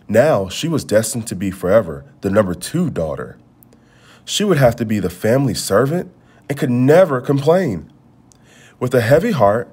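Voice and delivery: usual speaking voice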